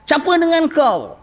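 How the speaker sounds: high, sharp challenge; harsh gatekeeper. Retold or imitated. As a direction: bark it like a suspicious guard. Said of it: "Ya, garangnya". (nada garang)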